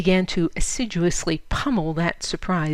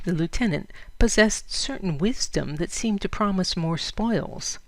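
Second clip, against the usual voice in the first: usual speaking voice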